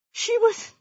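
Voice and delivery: high, stunned and broken off; aghast half-question; dawning horror. A narrator's reading. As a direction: echo it in shock, unfinished. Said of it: "all the horror of it".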